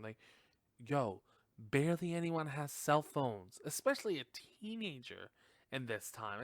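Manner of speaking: ordinary delivery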